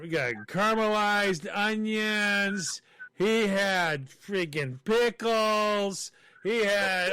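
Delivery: laboured, incredulous listing; comic indignation. Real, not acted. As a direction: stretch each item out in exasperation. Comic outrage at dog's meal.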